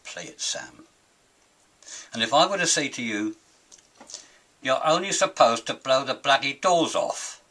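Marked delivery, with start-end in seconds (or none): 0.0-0.9 low, murmured tough-guy line; Humphrey Bogart. Retold or imitated. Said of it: "I think Bogart said". 4.6-7.4 louder, punchy Cockney gangster line; movie quote impression. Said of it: "we all know where that came from".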